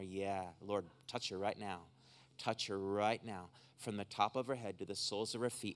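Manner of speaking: low, hushed prayer murmured over someone; hands-on healing prayer. Real, not acted. Preacher commanding pain to come out of her, low soft prayer. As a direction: pray low and soft, repeating words intently. Prays over a woman aloud.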